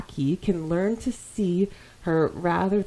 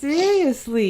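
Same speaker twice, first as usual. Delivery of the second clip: higher, louder seriously; exasperated; 9 semitones higher, 5 dB louder than usual. Real, not acted. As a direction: hit it again, fed up. Repeats in exasperation.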